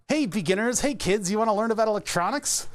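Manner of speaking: put-on high, chirpy kids' TV host voice; kids show host. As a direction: pitch it like a cheesy tutorial for children. Mocking cheery book for kids.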